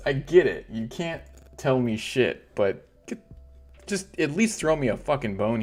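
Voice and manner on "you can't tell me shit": normal talking voice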